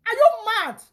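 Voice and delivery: real outburst of anger; furious shout. Real, not acted. Man yelling at his online critic, furious and loud.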